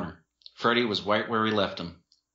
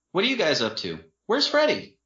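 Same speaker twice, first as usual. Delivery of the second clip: higher, bright woman's voice; a hint of a cheerful young woman; lightly voiced young woman; 9 semitones higher than usual. A narrator's reading. Jennifer voiced, high.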